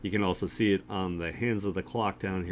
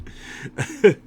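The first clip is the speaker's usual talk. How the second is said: high, loud laugh; real laugh; 13 semitones higher, 6 dB louder than usual. Real, not acted. Streamer tickled by how stupid Johnny Bravo is, laughing.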